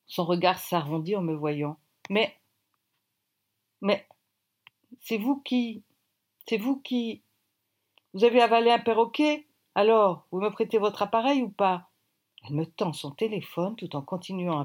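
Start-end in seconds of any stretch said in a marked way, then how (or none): 2.1-7.2 halting, higher-pitched disbelief; stunned stammer. A narrator's reading. Startled woman stammers on seeing narrator. 8.1-11.9 louder, higher, irritated retort; exasperated, raised-voice demand; impatient snap. A narrator's reading. Narrator snaps at stammering woman.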